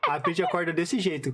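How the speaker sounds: usual speaking voice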